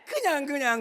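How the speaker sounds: ordinary talk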